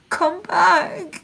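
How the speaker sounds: high, giddy call after someone walking away; calling after him. Retold or imitated. Calling after the flower giver.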